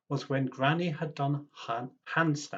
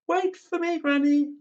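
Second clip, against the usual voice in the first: very high, louder call; 10 dB louder than usual. Acted. Tim chasing his granny, high loud call. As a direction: call after her as she runs. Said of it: "Tim called".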